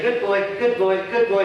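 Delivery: a hint of a doting owner; owner praising dog. Owner in the park praising the dog, lightly mimicked. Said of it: "my owner's saying".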